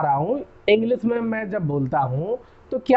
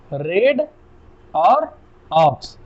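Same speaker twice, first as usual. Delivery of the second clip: रेड, और, aux said apart with pauses, और loudest; split into parts; 2 semitones lower, 5 dB louder, much slower than usual. Shown as a demonstration. Teacher splitting the word for students, slow separate syllables. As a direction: break redox into रेड and aux, pausing after each.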